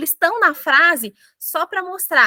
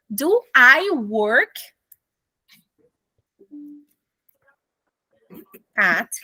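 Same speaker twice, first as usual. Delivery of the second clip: slow question; much slower than usual. Shown as a demonstration. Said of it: "eu quero me perguntar".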